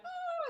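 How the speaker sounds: very high mock squeal; imitated crowd scream. Everybody hearing she won, imitated screaming. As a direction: squeal like a cheering crowd, not full volume. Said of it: "they're all screaming".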